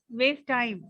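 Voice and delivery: ordinary talk, nothing marked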